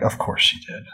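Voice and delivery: whispered, sarcastic aside; a dry, sarcastic whisper; whispered sarcasm. Retold or imitated. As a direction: breathe it out, unimpressed. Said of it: "I'm like".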